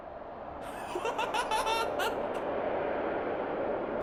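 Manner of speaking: breathless, high-pitched villain laugh; evil high laugh. Acted. Monster gloating as the chase starts, high evil laugh. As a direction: let a wicked, airy giggle run away with you.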